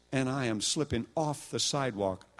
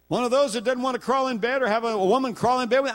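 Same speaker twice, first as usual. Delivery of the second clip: put-on high, fast accusing old lady; 13 semitones higher, 8 dB louder, faster than usual. Landlady at the locked door scolding the guest, mock high voice. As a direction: rattle it off like a prying old landlady.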